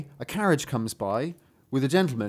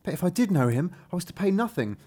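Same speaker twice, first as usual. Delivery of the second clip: higher, earnest character voice; troubled Hans, a worried father; 3 semitones higher than usual. Acted. Father telling a dwarf about his lost son, troubled. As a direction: lay out your plight with emphatic woe.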